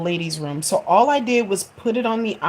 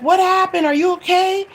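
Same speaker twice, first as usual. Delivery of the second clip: worried son; 13 semitones higher, 6 dB louder than usual. Retold or imitated. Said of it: "he was like".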